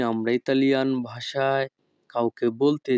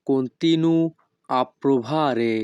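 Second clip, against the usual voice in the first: each Italian word spoken slowly and clearly; teacher modelling Italian for learners, very slow; slow demo; much slower than usual. Shown as a demonstration. Bengali teacher models Italian phrase for students, slow and careful. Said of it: "Italian phrase demo".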